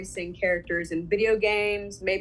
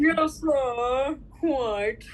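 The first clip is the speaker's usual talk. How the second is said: put-on drawn-out English accent; mock English butler; 3 semitones higher, much slower than usual. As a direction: answer like an English manservant. Playing along with English butler.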